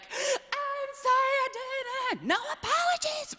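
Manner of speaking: mock yell. Coach yelling from her office to the speech kids, mock yell. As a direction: call it out playfully, stretched high. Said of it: "sometimes yelling from my office".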